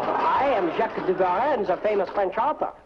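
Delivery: hammed-up fake French accent; bad French accent. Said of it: "a very bad French accent".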